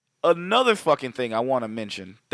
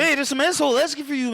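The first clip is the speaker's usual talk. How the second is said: high calling roommate; 11 semitones higher, faster than usual. Retold or imitated.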